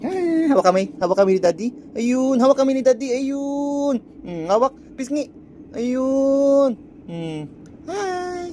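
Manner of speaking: delighted cooing. Real, not acted. Dad holding hands with baby, high delighted coo.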